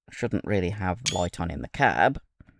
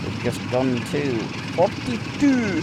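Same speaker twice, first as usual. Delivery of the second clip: put-on slow, higher Scottish accent; drawn-out Scottish accent, said on a whim; 5 semitones higher, slower than usual. Train driver reporting his speed to viewers, in a Scottish accent. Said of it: "I did that with a Scottish accent".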